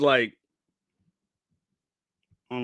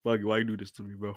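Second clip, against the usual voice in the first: ordinary talk, nothing marked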